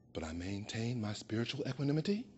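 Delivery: equanimity said low and hushed through clenched calm; forced calm, hushed. Acted. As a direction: swallow your anger and murmur it low. Refrain repeated, now forced calm.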